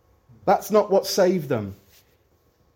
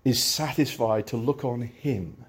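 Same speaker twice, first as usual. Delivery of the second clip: hymn recitation. Acted. Recites hymn verses slowly.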